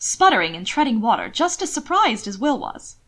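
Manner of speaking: ordinary delivery, nothing marked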